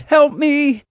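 loud, very high plea for help; desperate high-pitched shout; crying out. A narrator's reading. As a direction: cry out as if in danger. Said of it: "she cried".

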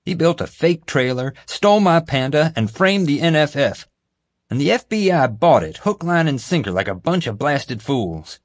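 furious at being framed; venting, building heat; angry. A narrator's reading. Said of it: "J J angrily pounded his desk".

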